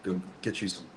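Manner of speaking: low, soft contrite voice; quiet, low-pitched admission of fault; subdued apology. Real, not acted. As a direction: drop your voice and apologize. Apologizing for bad behavior on camera.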